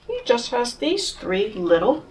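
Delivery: plain reading voice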